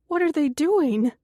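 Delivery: high, loud frightened question. Acted. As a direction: ask it scared, voice jumping up.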